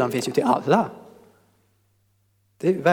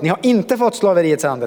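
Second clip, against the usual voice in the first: "inte" lifted high and strong; stress on INTE; 3 semitones higher, 4 dB louder than usual. Shown as a demonstration. Preacher rereading the verse gladly, stress on "inte". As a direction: lean hard on "inte", relieved. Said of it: "det var ett inte där".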